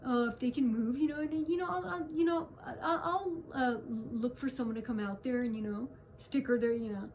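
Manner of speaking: a hint of a cowed, flustered man; stammering backdown. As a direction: mimic a caught man fumbling. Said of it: "He's like".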